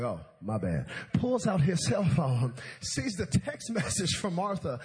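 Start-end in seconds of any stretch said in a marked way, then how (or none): 0.0-0.9 dropped low, offhand apology; sheepish admission. Acted. Jesus learns it's his own phone, low sheepish apology. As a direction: back down in a low voice, comically.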